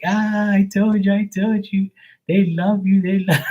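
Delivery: high, gloating imitation of a friend; imitating Sue. Sue gloating at Mark over the cards, high mocking voice. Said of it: "she'd be like".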